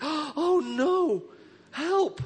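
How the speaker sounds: high, squeaky mock panic; hammed-up fearful cry. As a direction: cry out like a frightened believer. Panicked believer imitated.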